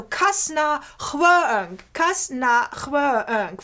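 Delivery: "kasna chweng" slow, the "chweng" stretched over two syllables; slurred syllables. Shown as a demonstration. Lecturer demonstrates the meter to students, slow stretched "chweng". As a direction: drawl "chweng" as xwe-eng to fill four syllables. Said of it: "with a slur in them".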